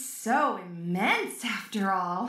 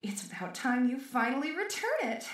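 usual speaking voice

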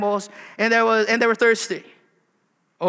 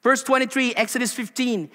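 ordinary delivery

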